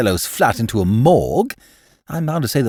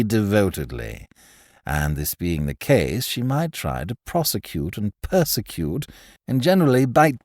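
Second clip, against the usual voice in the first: lazily superior, lowered voice; Pym, smug invalid; 2 semitones lower than usual. Acted.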